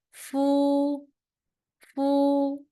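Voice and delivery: "Fu" held high and level; first tone fu. Shown as a demonstration. Teacher demonstrating the first tone on fu. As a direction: keep "Fu" flat and high. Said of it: "the first tone".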